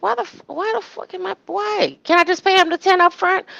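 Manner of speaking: mock incredulous Shirley; imitating exasperated Shirley. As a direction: squeak out her fed-up protest. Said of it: "It's like".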